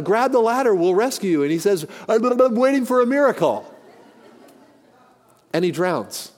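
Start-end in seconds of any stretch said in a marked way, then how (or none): 0.0-1.4 raised, higher-pitched rescuer's call; mock shout down to someone below. Helicopter crew to man in the flood, calling down. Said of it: "they shout down". 2.1-3.7 high, spluttering through rising water; comic burbling as if going under; drowning man. Acted. Flood victim refusing the helicopter's ladder, spluttering and high-pitched. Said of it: "just about ready to go under".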